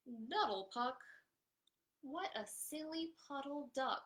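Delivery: lively picture-book reading; animated storytime narration; reading to children. A narrator's reading. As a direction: read it out to a circle of little kids.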